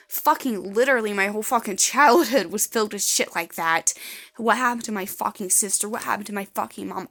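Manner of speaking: loud, furious outburst about her family; real anger. Real, not acted. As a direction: spit it out in real rage. Raging about her childhood abuse.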